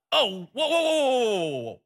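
high startled whoa whoa; alarmed whoa. Retold or imitated. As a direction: pull back in alarm. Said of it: "Martha's like".

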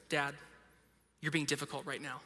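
low, soft deadpan scolding; small daughter's rebuke. Retold or imitated. Three-year-old daughter reproving dad, quiet deadpan imitation. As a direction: quietly tell Dad off, imitating a little girl. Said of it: "three-year-old daughter looks at me and goes".

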